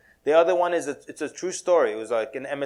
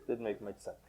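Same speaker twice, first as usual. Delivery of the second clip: "Didn't make much sense" dropped much softer and lower; soft echo of the line, said much quieter and lower; 5 semitones lower, 14 dB quieter than usual. Shown as a demonstration. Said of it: "echo of previous line".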